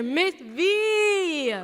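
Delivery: ordinary delivery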